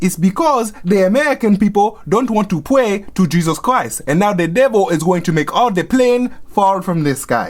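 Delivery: imitated Haitian accent, emphatic and matter-of-fact; mock Haitian mother laying down doom. Religious mother explains the beans to her child, imitated Haitian accent. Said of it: "my religious Haitian mother".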